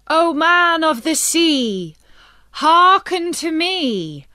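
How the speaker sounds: put-on old fisherman's sing-song summons; fisherman calling to the sea. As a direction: chant it out over the waves, pleading.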